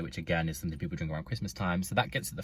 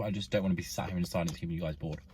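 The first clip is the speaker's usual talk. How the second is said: ordinary delivery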